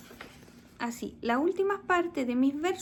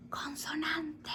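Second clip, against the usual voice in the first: slow, hushed whisper; whispered hint; 7 dB quieter, much slower than usual. Shown as a demonstration. Teacher slips pupils the answer to her rhyme question, whispered.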